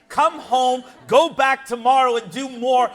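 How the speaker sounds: high, loud build to a yell; building crowd shout. Real, not acted. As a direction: drive the list up into a roaring call-out. Rallying audience from the stage.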